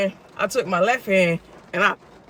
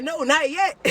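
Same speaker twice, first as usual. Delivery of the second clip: cocky; 9 semitones higher, slower than usual. Retold or imitated. Joke to dispatcher, retold.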